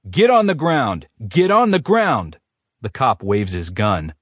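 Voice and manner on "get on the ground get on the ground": high, loud barked order; shouting cop. A narrator's reading. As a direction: force the suspect down. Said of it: "runs up shouting".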